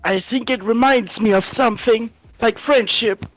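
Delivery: Aether. Acted. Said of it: "how [name] voiced Ether".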